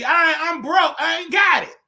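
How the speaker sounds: yelling at a phone scammer that she's broke; indignant shout. Pretend. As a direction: shout it like you're fed up with scammers.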